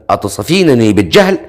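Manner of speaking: ordinary delivery, nothing marked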